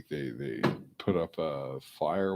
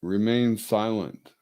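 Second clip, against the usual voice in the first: loud, higher stern order; 5 semitones higher, 9 dB louder than usual. Shown as a demonstration. Owner ordering dog to stay silent, loud and stern. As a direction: command the dog like an officer. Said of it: "See the vocal tricks?".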